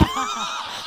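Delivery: triumphant squeal of a laugh; high laugh. Real, not acted.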